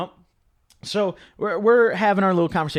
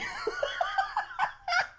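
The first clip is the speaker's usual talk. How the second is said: high shrieking laugh; 11 dB quieter than usual. Real, not acted. Shocked laughter at PCP request.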